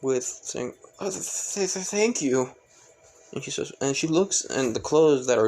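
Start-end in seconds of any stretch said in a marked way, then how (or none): 1.0-2.6 bashful, tripping over the first sound; flustered. Acted. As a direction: stutter shyly in a small raised voice. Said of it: "gets a bit flustered because of this".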